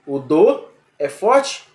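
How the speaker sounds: ordinary delivery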